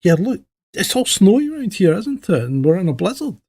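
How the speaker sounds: lightly voiced patient mother bear. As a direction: reassure the cub, a little weary. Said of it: "Mum goes".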